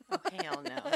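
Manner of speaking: high squeaky sassy "oh, hell no"; sassy devil. Retold or imitated. Friend echoes the devil quote, squeaky sassy. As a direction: toss it off like a diva devil. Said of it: "that's a devil's quote".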